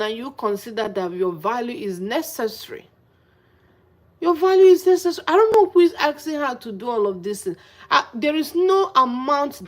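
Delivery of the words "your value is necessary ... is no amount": fast, high and loud scolding; heated indignation. Real, not acted. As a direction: rush it out in exasperation. Heated rant about self-respect.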